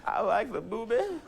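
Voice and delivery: goofy Clinton, laughing through it; Bill Clinton impression. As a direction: repeat the silly Clinton line. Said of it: "my President Clinton impression".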